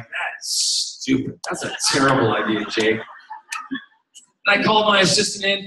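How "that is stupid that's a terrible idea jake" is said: tag. Retold or imitated. Retold blunt rejection of Jake's idea.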